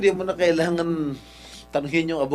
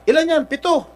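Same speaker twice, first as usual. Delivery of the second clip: sharp, high challenge; raised voice; 9 semitones higher, 5 dB louder than usual. Real, not acted. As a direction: demand it in a raised voice. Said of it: "raising my voice". (mataas na boses, naghahamon)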